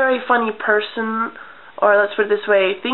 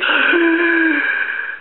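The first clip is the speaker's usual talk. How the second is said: high shocked gasp; 9 semitones higher than usual. Retold or imitated. Mom walking in on the Play-Doh mess, shocked gasp. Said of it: "she's like".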